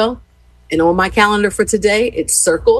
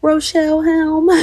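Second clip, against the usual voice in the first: proud name drop; 11 semitones higher, slower than usual. Retold or imitated. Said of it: "I was like".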